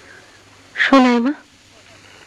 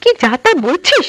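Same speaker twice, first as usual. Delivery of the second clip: indignant; 6 semitones higher than usual. Acted. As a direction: snap back in disbelief. Young queen rejects the maid's gossip.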